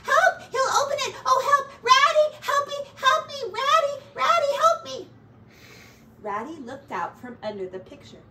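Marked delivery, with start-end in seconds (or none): 0.1-5.0 very high, loud squealing cries; panicked cry for help. A narrator's reading. Mouse, trapped with the badger outside, crying for help. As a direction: cry out in terror, pitched way up. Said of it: "cried Mouse".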